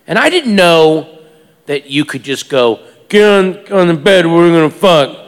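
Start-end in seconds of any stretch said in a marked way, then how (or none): 3.1-5.2 lightly voiced crude john, louder; mock boorish demand; crude come-on. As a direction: blurt it as a blunt order. Crude line to a prostitute.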